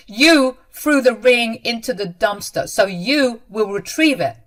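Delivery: loud, stern accusation; hard insistence. A narrator's reading. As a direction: order her to take the blame. Son insists in quarrel.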